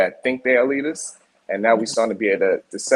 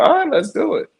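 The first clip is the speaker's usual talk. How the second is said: eager yes-man. Retold or imitated. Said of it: "They're like".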